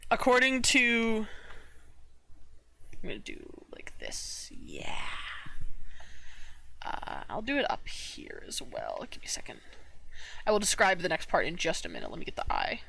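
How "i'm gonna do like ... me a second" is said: whispered aside to herself; breathy murmur under her breath; whispering. Real, not acted. Muttering to self while drawing.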